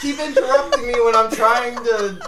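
hammed-up exasperated grandson. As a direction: complain in mock frustration at being interrupted. Caller scolds interrupting grandma.

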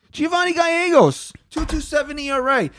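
high-pitched, exasperated outburst; incredulous rant. Real, not acted. Host berating Luke Voit over the trade, high incredulous rant. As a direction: hurl the stat out in a high voice. Heated rant at an absent player.

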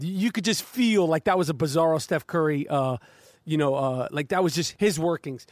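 ordinary talk

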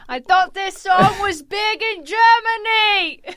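high, slow mock shout. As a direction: belt it out in fake outrage.

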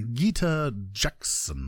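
low, soft, slow savouring of the name; drawn-out introduction voice. Shown as a demonstration. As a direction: croon the name slowly, stretching each syllable. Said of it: "I should just be like".

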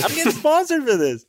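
put-on very high bratty kid voice; squeaky kid bragging to mom. Playing kid continuing joke.

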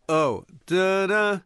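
slow reversed gibberish; backwards talking. Pretend. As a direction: answer like a reversed tape. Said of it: "now you have to, like, answer".

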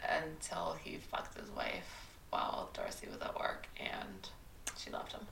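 hushed gossipy whisper. Real, not acted. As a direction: drop to a secret whisper.

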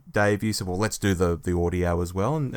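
normal talking voice

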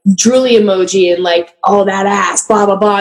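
ordinary delivery

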